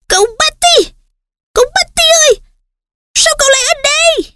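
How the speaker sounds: high piercing house-elf cry; shrill elf shriek. Acted. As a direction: screech it like a frantic little elf. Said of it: "thốt ra tiếng kêu the thé điếc óc".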